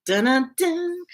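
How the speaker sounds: plain reading voice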